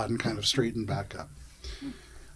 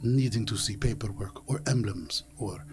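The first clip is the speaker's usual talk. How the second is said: leaning in, softer, partly whispered; hushed confidential; 3 semitones lower, 6 dB quieter than usual. Acted. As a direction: let the player in on a secret. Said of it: "They lean into you".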